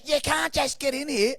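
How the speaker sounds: high nagging voice scolding at the ice bath; mocking a bossy woman telling them to shower; mock shrill woman. Woman quoted again.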